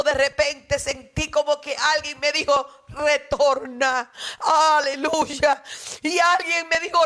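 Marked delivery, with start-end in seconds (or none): 2.9-5.6 a divine voice. Retold or imitated. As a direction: speak as a calm voice from heaven. A voice from heaven speaks.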